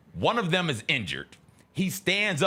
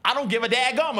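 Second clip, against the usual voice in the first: mock defiant yell; 10 semitones higher, 4 dB louder, faster than usual. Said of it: "He called himself".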